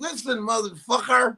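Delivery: high, odd-sounding cursing; Cosby cursing. Retold or imitated. Comic mimics his old boss cursing off camera, high and odd. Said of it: "it always sounded weird".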